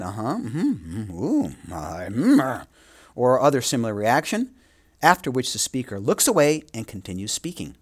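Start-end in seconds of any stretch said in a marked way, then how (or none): 0.0-2.7 murmured uh-huh and mm-hmm as a listener would; listener backchannel sounds. Retold or imitated. Lecturer to students, imitating a listener's mild murmurs. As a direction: play the nodding listener. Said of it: "listener is likely to respond with a nod".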